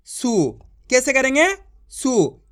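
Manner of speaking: सु with a short, clipped u; short u sound. Shown as a demonstration. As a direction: say सु without stretching the vowel. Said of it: "इतना ही खींच के करना है आपको".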